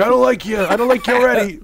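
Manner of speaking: high, pointed brush-off in a put-on voice; hammed-up Mickey Rourke put-down; mock tough guy. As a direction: sneer it like a hostile climber. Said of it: "Mickey Rourke's like".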